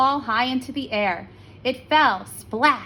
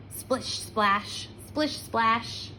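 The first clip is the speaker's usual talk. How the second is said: sound effect; 5 dB quieter, much slower than usual. A narrator's reading. Sound of wet feet.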